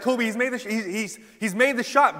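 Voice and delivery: high, excited play-by-play call; sports announcer. Retold or imitated. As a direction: imitate a commentator calling the big basket. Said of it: "but the announcer is explaining".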